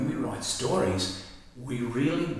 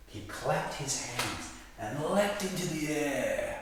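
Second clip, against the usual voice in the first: slow, bouncy read with lifted pitch; lively reading; 6 semitones higher, 5 dB quieter than usual. Shown as a demonstration. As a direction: read it full of joy. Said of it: "show something like this".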